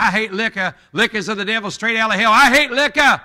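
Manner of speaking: thundering Southern preacher, high and loud; impassioned Southern-accented rant, rising to a yell; old Southern accent. Retold or imitated. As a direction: bellow it in an old Southern accent, building to the last words. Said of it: "He had that old accent, southern accent".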